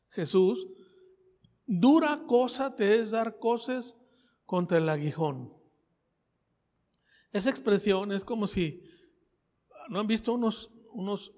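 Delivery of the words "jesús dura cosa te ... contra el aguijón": slow, louder preacher's proclamation; solemn sermon delivery of the verse; preaching scripture. Acted.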